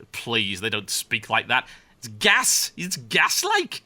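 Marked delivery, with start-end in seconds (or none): 2.0-3.8 high-pitched, drawn-out Geordie; Geordie accent. Acted. British caster showing how he'd say gas back home, Geordie accent. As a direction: slip into a put-on Geordie lilt. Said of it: "I'm not doing the Geordie casting".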